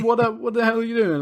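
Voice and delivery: fast, high exasperated rant; exasperated robber. Retold or imitated. Robber complaining to Arnie about the lost millions. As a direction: rattle it off in disbelief. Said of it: "he's like".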